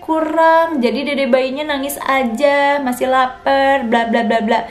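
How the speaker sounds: high, prying mock voice of nosy visitors. Visitors doubting a new mother's milk, high prying mock voice. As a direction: ham up the doubtful relatives. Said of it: "yang nanya ya".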